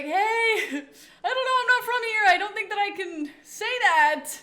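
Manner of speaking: high, loud, laughing mock protest; retold laughing protest. Visitor answering Birmingham locals, laughing mock protest.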